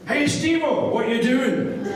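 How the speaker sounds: chirpy mimicry of a mate calling; imitating Davey. Voicing Davey on the phone.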